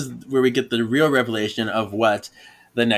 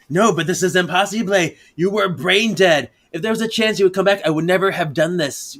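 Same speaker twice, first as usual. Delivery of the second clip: higher, fast, rushed protest; agitated Prue; 7 semitones higher, faster than usual. Acted. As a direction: rattle it off in a higher, frantic voice. Prue protests, acted comic quote.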